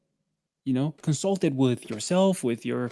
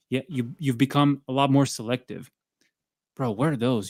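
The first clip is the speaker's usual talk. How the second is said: ordinary talk, nothing marked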